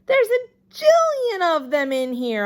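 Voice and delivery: high, loud thrilled shout; a kid shouting in awe; excited holler. A narrator's reading. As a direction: burst out with delight. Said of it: "I hollered, feeling very excited".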